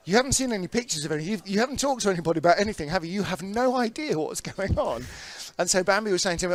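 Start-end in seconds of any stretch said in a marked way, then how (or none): 0.1-5.0 incredulous rush. Retold or imitated. Speaker retelling his own reply.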